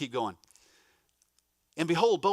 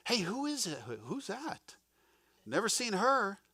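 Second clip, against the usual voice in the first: intrigued noticing; 5 semitones higher than usual. Pretend. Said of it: "You hear my inflection".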